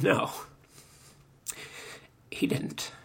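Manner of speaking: real tears catching in the throat, words breaking to a whisper; choked, barely getting the words out. Real, not acted. Preacher retelling the mobster's grief, choked up. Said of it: "I get Jerry choked up talking about it".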